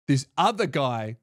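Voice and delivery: ordinary talk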